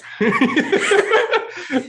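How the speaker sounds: high burst of laughter. Real, not acted. Host laughing at spice.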